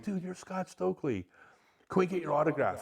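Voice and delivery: high, eager fan voice; starstruck fans. Retold or imitated. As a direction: gush like fans meeting a hero. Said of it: "They're like".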